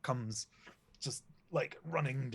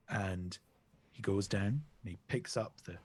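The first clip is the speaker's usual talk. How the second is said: usual speaking voice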